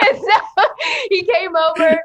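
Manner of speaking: can't stop laughing while she tells it; laughing through words. Real, not acted. Laughing as she tells the host her story.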